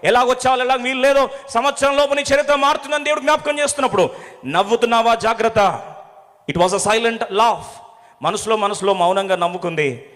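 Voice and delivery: loud, high preaching with rising fervour; full-force preaching. Acted. A preacher challenging the congregation's doubts, loudly. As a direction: drive the warning home to the congregation.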